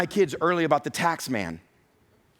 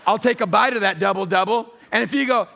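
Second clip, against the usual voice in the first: higher, louder gleeful boast with laughter; gleeful teasing; 5 semitones higher, 5 dB louder than usual. Retold or imitated. Dad teasing his kids over food, gleeful laughing. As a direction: gloat playfully like a greedy dad. Acts greedy dad taking bites.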